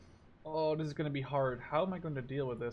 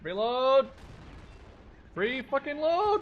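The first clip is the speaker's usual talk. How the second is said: higher, louder, drawn-out shout; impatient yell; 11 semitones higher, 9 dB louder, slower than usual. Real, not acted. Gamer yelling at his gun to reload, high and loud. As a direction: bark it at the slow reload.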